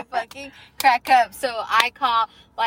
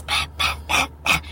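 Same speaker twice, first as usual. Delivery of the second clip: whispered beat sounds. Pretend. Imitating a song beat.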